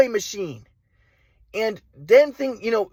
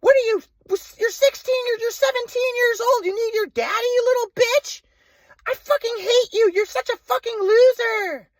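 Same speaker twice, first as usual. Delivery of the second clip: much higher, slow screeching mom caricature; mocking mom voice. Son mimics mom cursing him on the phone, high mocking screech. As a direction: spit the insults high and drawn out like his mom. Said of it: "My mom's like".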